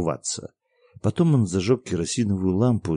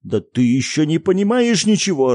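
angry father; 8 semitones higher, 7 dB louder than usual. A narrator's reading. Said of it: "рассерчал отец".

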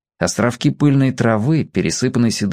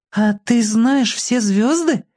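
put-on high, slow, wondering girl; surprised girl; 10 semitones higher, slower than usual. A narrator's reading. Girl asking the boy about stars, surprised. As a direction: ask slowly in a high child's voice, amazed. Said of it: "удивлённо спросила девочка".

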